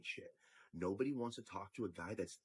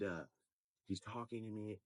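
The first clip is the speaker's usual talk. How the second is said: ordinary talk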